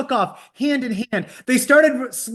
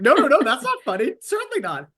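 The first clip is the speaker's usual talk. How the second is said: higher, prim voice of mock disapproval; prim mock scolding; 7 semitones higher than usual. Laughing at the joke, then primly scolding herself. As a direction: scold yourself primly, tongue in cheek. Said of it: "I was like".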